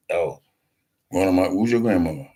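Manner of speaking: normal talking voice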